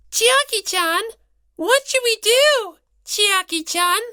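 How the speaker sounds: very high, cute little mascot voice; a tiny rabbit worrying aloud; squeaky mascot. Acted. Monami asking Chiaki for help in a high cute voice.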